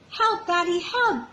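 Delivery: high, alarmed cry for help. Acted. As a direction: call out from the mud. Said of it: "cried Little Squirrel".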